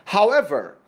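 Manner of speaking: usual speaking voice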